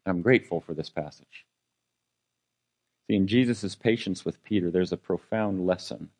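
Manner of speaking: ordinary talk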